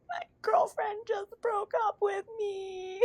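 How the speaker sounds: a lightly mocked heartbroken stranger; 1 mock heartbroken guy. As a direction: play the dumped guy, slow and high. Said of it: "he's like".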